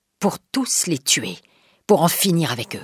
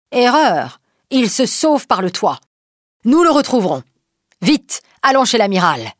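higher, louder alarmed cry; loud exclamation; 6 semitones higher, 4 dB louder than usual. Acted. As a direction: shout the warning out the window. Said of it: "s'écrie".

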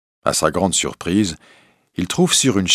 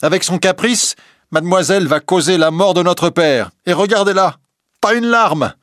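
much higher, loud, shrill-edged sisters' cry; jealous sisters shouting; 11 semitones higher, 5 dB louder than usual. Acted. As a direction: scold their sister with spite. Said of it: "se mettent alors à crier". (les sœurs crient contre la Belle, voix aiguë et forte)

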